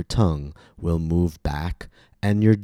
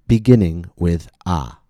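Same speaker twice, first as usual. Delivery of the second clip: ordinary talk, nothing marked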